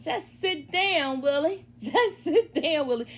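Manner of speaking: slow, lightly imitated southern drawl; a mild mock southern "just sit down"; hint of a southern accent, slow and soothing. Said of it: "her little southern a-, accent".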